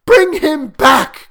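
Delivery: high, loud, drawn-out demand; desperate, shrieked command; crying out. A narrator's reading. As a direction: wail it slowly at full voice. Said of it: "cried the old woman".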